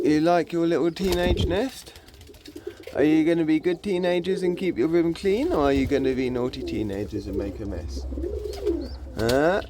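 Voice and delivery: teasing, doting pet voice; doting pet talk. Real, not acted. Talking to young pigeons in nest.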